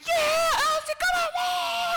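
high, loud yell for his daughter; dad yelling for his daughter at the race; crazy cheering. Retold or imitated. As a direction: scream your heart out from the stands. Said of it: "Chad goes crazy".

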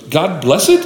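"God bless it?" with a doubtful rise on "bless it"; questioning prayer. Retold or imitated. Unsure golfer trying out a prayer, questioning. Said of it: "more of a question than a prayer".

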